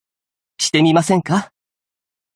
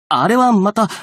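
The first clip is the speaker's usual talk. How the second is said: higher-pitched, flustered objection; flustered protest; 8 semitones higher than usual. Acted. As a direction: protest hurriedly that it doesn't count, voice jumping up. Protests when teased about past bravado.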